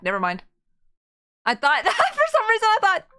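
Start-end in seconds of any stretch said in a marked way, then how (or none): 1.4-3.1 excited. Real, not acted. Excited mistaken recognition.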